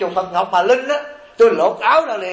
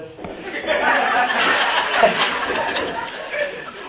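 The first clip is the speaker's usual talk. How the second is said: tiếng cười khẽ bật ra, gần như không thành tiếng; cười khúc khích giữa câu giảng; 4 semitones higher, 4 dB quieter than usual. Real, not acted. (cười khẽ thích thú)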